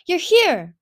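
high, loud, delighted greeting; thrilled exclamation; excited call. A narrator's reading. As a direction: cry out on seeing a friend. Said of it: "she called, bounding over".

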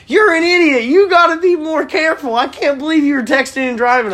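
mock-shriek the mom's scolding; hammed-up furious mother scolding her son. Mom scolding him in story.

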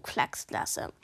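plain reading voice

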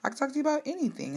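a friend's quick reassurance; quoting a friend. Retold or imitated. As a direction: rush to reassure. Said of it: "She was like".

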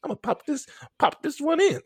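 high, excited quoted thought; bouncy and high, acting out the moment; giddy self-talk. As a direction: pitch it high like a gleeful announcement. Retelling own excited thought.

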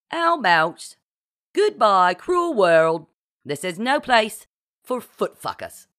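loud, high theatrical declaration; mock-dramatic proposal. A narrator's reading. Kit suggests a suicide note line, mock-dramatically. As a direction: proclaim it like a melodramatic farewell.

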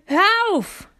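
very high, loud cry to stop him; shouting. A narrator's reading. Girl yelling at her dad to stop, full shout. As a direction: yell it as loud as you can. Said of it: "rief ich, so laut ich konnte".

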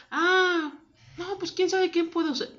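clueless receptionist. Retold or imitated. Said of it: "Así con cara de".

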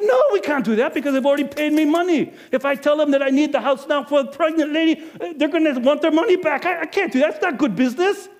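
high, loud businessman's no; an innkeeper refusing his wife, high and loud; firm refusal. Acted. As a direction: shut the idea down for money. Refusing to move guests.

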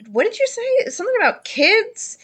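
high, puzzled question; curious kid. Acted. Suspicious young partygoer questioning Zyrel, high and puzzled. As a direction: perk up at the word kids. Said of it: "They say".

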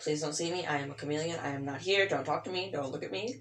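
soft, hushed plea to be left alone; shy pleading. Retold or imitated. Acting out hiding from coworkers, soft hushed plea. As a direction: murmur it like you're trying to vanish. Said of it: "Usually I literally am just like".

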